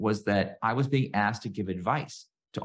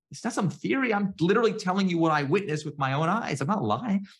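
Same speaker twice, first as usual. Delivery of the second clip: pitched-up, pleading protest; upset protest; 9 semitones higher than usual. Retold or imitated. To the people doubting his stories, a high upset insistence. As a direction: insist you are telling the truth, exasperated. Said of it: "I was so upset".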